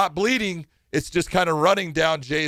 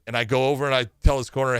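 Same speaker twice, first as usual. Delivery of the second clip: ordinary talk, nothing marked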